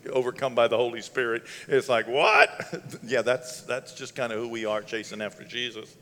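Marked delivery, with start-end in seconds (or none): 2.1-2.5 loud, rising "what?"; a burst of comic astonishment; incredulous. Acted. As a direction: blurt it in mock disbelief. Astonished reaction to odd worship leader.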